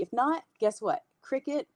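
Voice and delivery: normal talking voice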